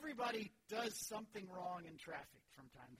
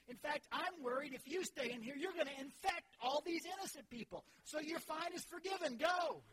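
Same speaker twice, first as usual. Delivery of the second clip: louder, higher mock outrage building to a shout; mock indignant judge; 7 semitones higher, 6 dB louder than usual. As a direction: drive him out of the courtroom. Judge dismisses guilty man.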